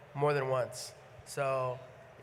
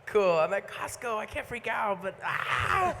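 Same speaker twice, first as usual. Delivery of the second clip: excited; 6 semitones higher than usual. Retold or imitated. Excited but restrained in Costco.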